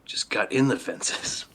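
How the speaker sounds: breathy whisper, slowed down; whispered aside. Real, not acted. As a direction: mutter it under your breath. Quiet aside at the table.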